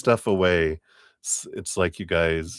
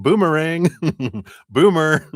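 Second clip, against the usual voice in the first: higher, louder joking boomerang; playful; 8 semitones higher, 7 dB louder than usual. Pretend. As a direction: toss out the pun loudly. Playful punchline shout.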